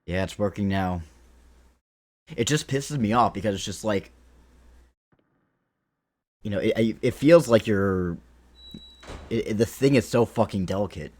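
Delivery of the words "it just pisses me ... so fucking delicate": louder, worked-up rant over a broken stream; venting real frustration at the software; fed-up ranting, getting heated. Real, not acted. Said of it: "Sorry, I'll calm down".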